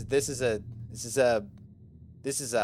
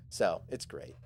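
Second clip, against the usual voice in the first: ordinary delivery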